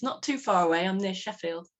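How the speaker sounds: ordinary delivery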